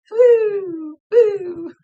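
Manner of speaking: loud, high gleeful whoop; carefree high cheer; joyful whoop. Retold or imitated. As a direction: whoop like a kid at play. Spirit frolicking, dancing away.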